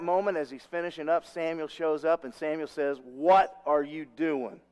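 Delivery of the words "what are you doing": slow, louder, weighty confrontation; acted slow, emphatic reproach; stern disbelief. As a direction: draw out each word, confronting a wrongdoer. Samuel confronts Saul, aghast.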